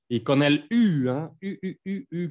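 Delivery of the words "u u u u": "U" as a rounded French u, repeated. Shown as a demonstration. Teacher demonstrating the vowel to Spanish speakers, "U" as French u. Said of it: "como el U francés".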